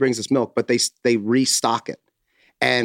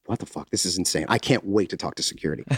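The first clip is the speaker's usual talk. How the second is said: muttered disbelief; 6 semitones lower, 6 dB quieter, faster than usual. Retold or imitated. Retold private disbelief.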